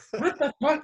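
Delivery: high-pitched incredulous outburst; mock disbelief. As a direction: squeak it out, half laughing. Acting out disbelief at friend's criticism.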